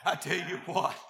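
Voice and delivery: ordinary delivery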